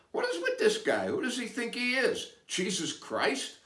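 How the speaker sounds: baffled onlookers. Retold or imitated. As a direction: ask it like strangers who can't believe it. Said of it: "the four guys look at Moses and go".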